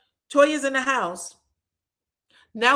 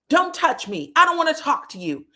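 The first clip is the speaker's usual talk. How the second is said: mildly indignant, higher-pitched retort; raised-pitch mock rebuff; 7 semitones higher, 4 dB louder than usual. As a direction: act out brushing off a partner. Retold quarrel, rebuffing partner.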